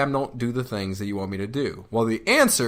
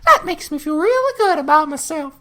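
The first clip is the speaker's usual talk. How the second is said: Disney gush; 7 dB louder than usual. Pretend. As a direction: overact the delighted movie hero. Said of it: "directed by Disney".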